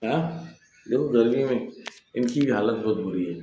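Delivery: normal talking voice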